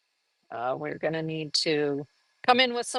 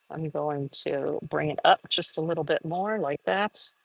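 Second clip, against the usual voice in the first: ordinary talk, nothing marked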